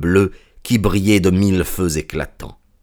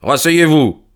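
firm, severe order; stern; 7 semitones higher, 4 dB louder than usual. A narrator's reading. Detective to the grovelling culprit, sternly. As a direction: cut the begging short with a stern command. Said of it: "dit Holmes sévèrement".

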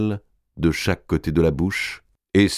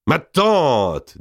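a touch higher, bold address; lightly voiced Robert; 8 semitones higher than usual. A narrator's reading. Robert turns on his aunt, lightly voiced and bold. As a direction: confront the aunt. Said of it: "dit Robert".

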